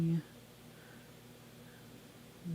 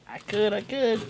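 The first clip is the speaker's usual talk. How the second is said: emphatic; 7 semitones higher, 6 dB louder than usual. Acted. Playful insistence.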